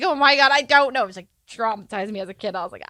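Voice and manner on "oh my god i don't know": flustered. Retold or imitated. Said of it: "she was yelling at me".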